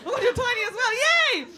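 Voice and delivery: high, loud, giddy squeal; excited squeal. Real, not acted. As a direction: squeal with delight. Squealing at a petite woman.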